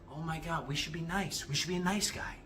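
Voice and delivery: soft, small voice of the nice guy; timid inner voice. Acted. The voice in the back of the head, timid and soft. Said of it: "in the back of the head that tells you".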